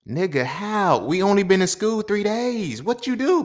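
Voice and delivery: loud, high incredulous yell; shocked disbelief. Retold or imitated. Reading his friend's text about getting suspended, stunned. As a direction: react in total disbelief. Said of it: "text exchange".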